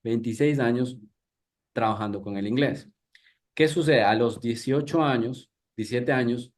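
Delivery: ordinary delivery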